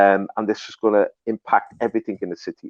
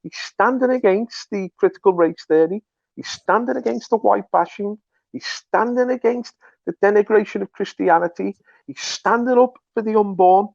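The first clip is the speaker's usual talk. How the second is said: much higher, rising with zeal; fervent praise; 10 semitones higher than usual. Real, not acted. To the host, hailing Trump as anointed, fervent and much higher. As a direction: lift it up like a fervent sermon.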